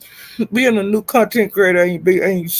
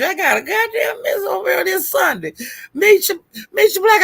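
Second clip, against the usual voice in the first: higher, laughing cry for help; frantic half-laugh; 9 semitones higher than usual. Real, not acted. Streamer calling viewers for help, laughing panic. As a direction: call out in playful panic.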